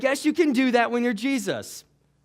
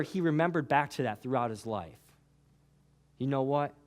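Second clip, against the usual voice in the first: softer, lower, intimate; soft altar-call preaching; 10 semitones lower, 8 dB quieter than usual. Acted. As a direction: lower your voice and draw them in. Soft closing appeal.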